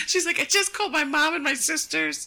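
high quote through laughter; laughing. Retold or imitated. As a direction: quote her panic while laughing. Retelling friend's panic, laughing.

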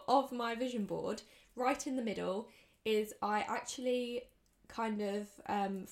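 normal talking voice

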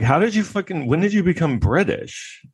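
high mock disbelief; incredulous. Comic asking Depp in high disbelief. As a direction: ask it pitched high, incredulous. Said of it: "I'm like".

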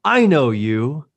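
loud, slow, drawn-out placating call; Gene Wilder. Retold or imitated. Steps between buddy and giant, loud slow movie-style soothing. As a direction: stretch each word out, booming and theatrical. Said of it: "I turned into Gene Wilder from Young Frankenstein".

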